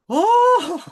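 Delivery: high sob-laugh breaking out; real emotion spilling into a teary laugh; tearful laughing cry. Real, not acted. Coach tearing up mid-message, sob-laughing. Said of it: "I'm starting to cry".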